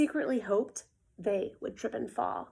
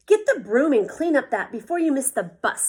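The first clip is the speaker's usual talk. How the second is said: scolding; 3 semitones higher, 7 dB louder than usual. A narrator's reading. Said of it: "scolded Mom".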